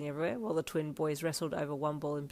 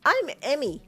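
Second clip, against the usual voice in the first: put-on high loud little girl; excited kid voice; 9 semitones higher, 9 dB louder than usual. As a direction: beam it out like a bold kid. Excited child voice.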